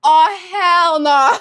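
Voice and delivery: loud, drawn-out outraged cry; shocked yell. Real, not acted. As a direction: belt it out in disbelief. Shocked outburst at something.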